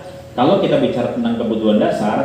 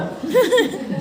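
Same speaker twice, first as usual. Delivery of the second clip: a real amused laugh, much higher. Real, not acted. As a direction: burst of high laughter. Laugh at stressed parents.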